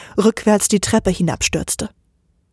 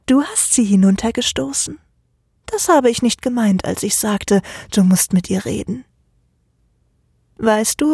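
lightly voiced dark accuser, dropping to a whisper; a hint of sinister Oleg. Oleg turns the blame back on Michael, menacing.

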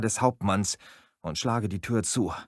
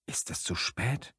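softer, whispered fear; fearful whisper; 6 dB quieter than usual. Acted. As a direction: breathe the question in dread. Narrator says he is afraid.

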